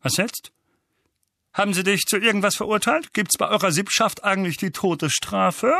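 high, quick, eager questioning; Onkel Vernon, hopeful. A narrator's reading. Uncle asks about Harry's possible death penalty, hopeful. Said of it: "fügte er hoffnungsvoll hinzu".

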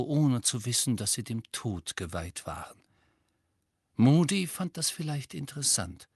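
plain reading voice

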